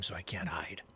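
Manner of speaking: whispered aside. Real, not acted. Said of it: "whispered aside".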